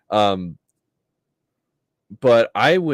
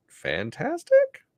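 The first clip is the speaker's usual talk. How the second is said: drawn-out, much higher sarcastic fantastic; much slower than usual. Acted. Mocking the film's terrible accents, slow high sarcastic drawl. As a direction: stretch it out slowly in high mock praise.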